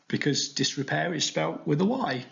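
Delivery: usual speaking voice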